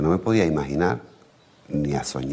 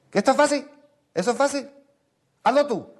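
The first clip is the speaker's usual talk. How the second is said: indignant brother. Retold or imitated. Brother challenging back.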